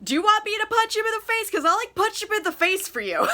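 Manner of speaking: high, fast, indignant offer to punch; mock-furious friend ready to fight; righteous anger. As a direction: play the outraged protector, half laughing. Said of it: "righteously angry on her behalf".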